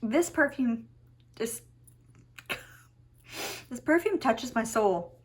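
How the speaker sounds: choked up, halting; tearful. Real, not acted. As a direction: restart through real tears, deeply moved. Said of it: "I'm gonna cry".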